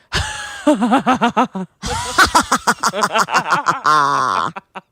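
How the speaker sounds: long cackling villain laugh; evil laugh. Shown as a demonstration. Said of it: "like, an evil laugh".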